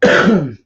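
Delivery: harsh hacking cough; one rough smoker's hack. Real, not acted. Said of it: "that's smoker's cough".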